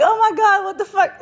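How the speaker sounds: high-pitched loud laughing outcry; overwhelmed exclamation. Real, not acted. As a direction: blurt it out, still shaken and cracking up. Reliving the terror, laughing at it.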